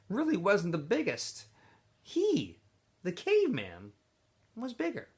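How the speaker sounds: expressive read-aloud of a chapter; story narration. A narrator's reading. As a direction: tell the story to a classroom. Teacher reads novel aloud.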